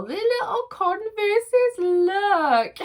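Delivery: high, slow cooing over something cute; delighted high coo, words stretched out; squealing delight. Real, not acted. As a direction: coo at it like a tiny treasure.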